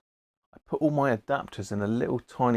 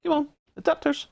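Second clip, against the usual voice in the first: much higher, quick squeaky plea; squeaky coaxing call; faster than usual. Pretend. Radio man hunting for adapters, squeaky coaxing call. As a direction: coax them out in a silly high voice.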